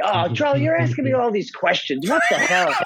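a grumpy dad fed up with questions, hammed-up and laughing; mock exasperated New York dad. Dad on the phone, annoyed by his son's questions, mock exasperated. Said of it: "He said".

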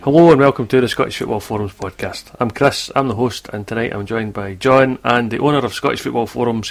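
normal talking voice

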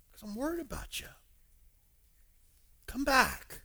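high-pitched, earnest appeal; pleading concern. Retold or imitated. Paul begging the church to return, pleading.

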